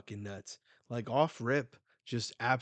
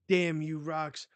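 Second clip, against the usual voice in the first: angry shout; 5 semitones higher, 5 dB louder than usual. Acted. Big Mom yelling at Rox.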